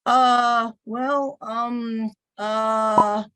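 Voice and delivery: drawn-out hesitant fillers, fumbling on purpose; deliberate filler words. Shown as a demonstration. Teacher demoing bad habits to the class, hesitant fillers. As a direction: stall like a nervous presenter. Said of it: "I'm deliberately gonna do some things I shouldn't do".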